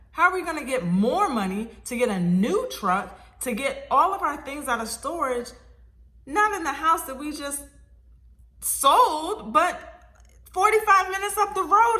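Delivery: rising, frantic retold rant; exasperated pile-up of complaints; a little crazy. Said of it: "I was a little crazy on the phone".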